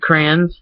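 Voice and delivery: "crayons" as one syllable, CRANS; Michigan pronunciation. Shown as a demonstration. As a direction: rhyme crayons with fans. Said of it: "like, C R A N S".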